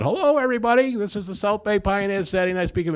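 loud, high call to order; opening the meeting. Retold or imitated. Dan calling the meeting to order, high and loud. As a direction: announce it to the whole room.

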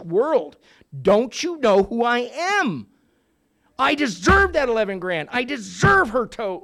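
loud, slow, indignant rant; retold rage. Recalling his rage at the meeting, loud and slow. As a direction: relive the injustice out loud. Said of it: "and I'm rageful".

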